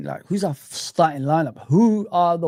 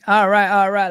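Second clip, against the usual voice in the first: Matthew McConaughey; 4 semitones higher, 6 dB louder than usual. Retold or imitated. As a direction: ham up the laid-back Texan "all right". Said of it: "Like Matthew McConaughey".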